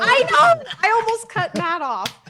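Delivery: high, loud excited squeal. Real, not acted. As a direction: burst out with excitement. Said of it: "I was so excited".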